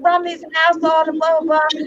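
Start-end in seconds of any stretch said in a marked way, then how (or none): none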